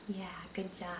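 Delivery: breathy hushed praise; whispered praise. Real, not acted. Teacher to young reader, hushed whispered good job.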